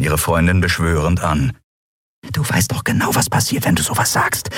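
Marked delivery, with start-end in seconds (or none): 2.3-4.5 hushed, whispered warning; urgent whisper. Acted. Nelen hushing Endriel with a hand over her mouth, whispering. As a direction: whisper it right in her ear.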